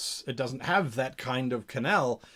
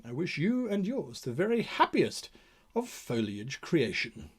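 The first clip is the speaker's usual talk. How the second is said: ordinary talk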